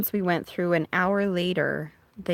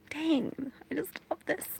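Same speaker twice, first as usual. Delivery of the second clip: awed sigh; 8 semitones higher, 6 dB quieter than usual. Real, not acted. Sighing in awe at storm.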